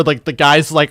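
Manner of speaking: ordinary talk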